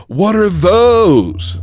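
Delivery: second try at the mocking meme; meme shout. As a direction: drag it out loud like the meme. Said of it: "He went".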